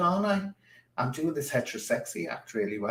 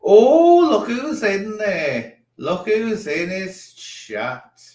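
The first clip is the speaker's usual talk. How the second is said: loud high "Oh", then slow, drawn-out teasing; mock delight; 9 semitones higher, 9 dB louder, slower than usual. Real, not acted. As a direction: greet him with sly glee. Teasing a guest who joined.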